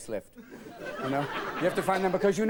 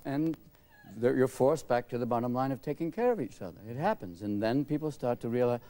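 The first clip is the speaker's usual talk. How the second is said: usual speaking voice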